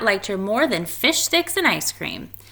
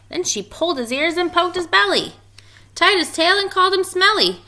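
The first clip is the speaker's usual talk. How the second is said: higher, louder lively read; lively teasing; 10 semitones higher, 5 dB louder than usual. A narrator's reading. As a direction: rattle off the teasing with rising energy. Teasing list read with energy.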